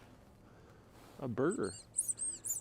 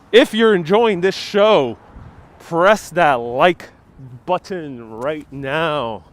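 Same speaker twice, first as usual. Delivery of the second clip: slow emphatic plea; 4 dB louder, slower than usual. Acted. Drawn-out plea for likes.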